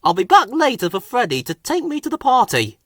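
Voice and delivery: cheerful boss heading off; controller voice. A narrator's reading. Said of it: "said the Finn controller".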